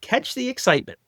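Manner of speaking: high sarcastic ad pitch; mock slogan. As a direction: sell it sarcastically.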